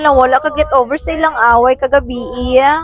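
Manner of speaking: loud playful shout. Real, not acted. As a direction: yell it out across the bridge.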